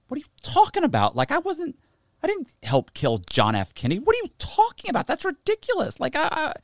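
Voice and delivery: high, exasperated, acting out the denial; incredulous outburst. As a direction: climb way up, as if the accusation is absurd. Retold outburst against an accusation.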